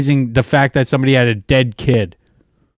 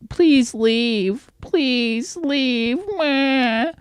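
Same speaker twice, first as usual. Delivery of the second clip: high nasal-free mock whine, much higher; mocking whiny Ash; 12 semitones higher, much slower than usual. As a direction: mimic Ash begging him to leave, mockingly high. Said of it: "Ash was like".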